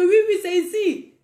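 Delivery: an eager yes from the neighbours. Retold or imitated.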